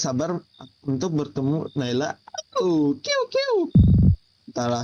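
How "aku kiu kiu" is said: tiny very high squeaking call; squeaky critter. Acted. Gamer voicing a little creature, very high squeak. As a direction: squeak it like a small bird.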